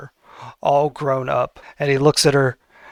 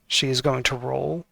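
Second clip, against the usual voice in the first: ordinary talk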